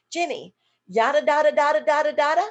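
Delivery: loud sing-song yada da da; rhythmic gibberish question. Shown as a demonstration. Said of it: "What Jenny is hearing is".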